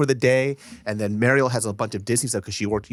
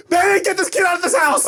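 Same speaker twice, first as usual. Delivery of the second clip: much higher, louder comic shriek; mock shriek; 4 dB louder than usual. Host imitating the mom shrieking about the kid, mock shriek. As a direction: yell it like a fed-up mom. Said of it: "She's like".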